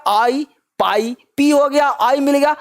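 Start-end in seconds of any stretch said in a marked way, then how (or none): 0.1-1.2 "आई, पाई" very slowly, each one separate; sound drill. Shown as a demonstration. Teacher builds the syllable for students, slow "आई, पाई" with eye vowels. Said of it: "आई का sound क्या".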